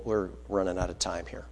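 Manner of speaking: ordinary talk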